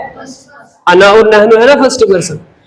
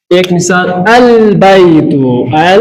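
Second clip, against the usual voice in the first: slow model reading; 4 semitones lower, much slower than usual. Shown as a demonstration. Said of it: "और एक मिसाल थोड़ा समझते हैं".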